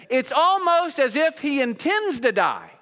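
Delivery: high, loud, desperate plea; pleading Pilate. Retold or imitated. Preacher voicing Pilate begging Jesus, loud and pleading. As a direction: beg as a frightened governor would. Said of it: "he would say".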